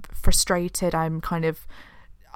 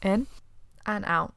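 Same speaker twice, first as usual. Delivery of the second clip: guided breath; 6 dB quieter than usual. Shown as a demonstration. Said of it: "maybe we should do one now".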